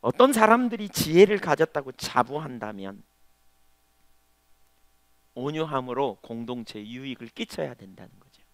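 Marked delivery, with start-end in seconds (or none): none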